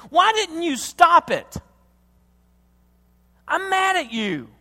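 high, loud, drawn-out accusation; acted furious cry to God; angry outburst. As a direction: let the anger burst out at God. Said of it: "I get mad and I say".

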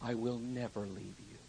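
very soft, low, slow promises; tender hush. Acted. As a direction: whisper the promise like a comfort at a bedside. Soft quoted promises of God.

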